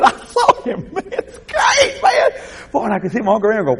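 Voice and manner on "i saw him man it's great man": high, laughing outburst; excited. Retold or imitated. Nephew telling his uncle he saw grandfather, excited.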